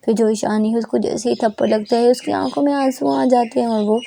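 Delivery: ordinary delivery, nothing marked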